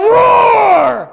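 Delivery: long, high-pitched pretend lion roar. Preacher voicing the lion coming closer, roaring. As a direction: swell into a big mock roar. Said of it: "came a little bit further and went".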